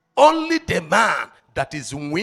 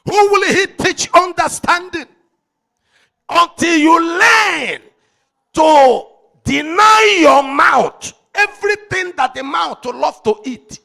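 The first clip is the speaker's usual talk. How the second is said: preaching at full force; 9 semitones higher, 8 dB louder than usual. Acted. Preacher roaring his message at the congregation, full force. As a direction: thunder it out to the congregation.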